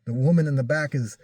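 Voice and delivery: ordinary delivery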